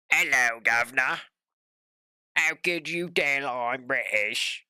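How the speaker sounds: hammed-up cheery-greeting British accent; mock British accent. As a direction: play up a cartoon British voice.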